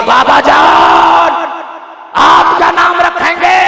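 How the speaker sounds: soaring high sermon shout, words drawn out; full-force preaching. Acted. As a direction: preach it at the top of your voice like a joyful proclamation. Preaching climax, mother's reply.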